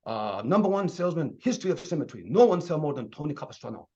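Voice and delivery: put-on thick Vietnamese accent; Tony Capistrano, Vietnamese accent. Trainer imitating the number one salesman in fun, Vietnamese accent. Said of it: "thick Vietnamese accent".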